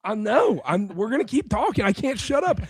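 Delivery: strained, jumping up high; worn-out voice. Real, not acted. Host admitting he can't rest his voice, strained and high. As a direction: insist through a failing high voice. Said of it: "pray that this voice comes back".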